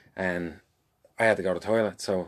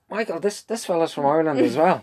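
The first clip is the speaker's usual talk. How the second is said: put-on much higher, laughing girlfriend's voice; Colleen's voice; 8 semitones higher, 4 dB louder than usual. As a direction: mimic her, high and amused. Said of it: "Colleen goes".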